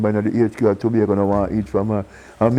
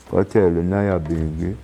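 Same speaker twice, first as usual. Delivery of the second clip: ordinary talk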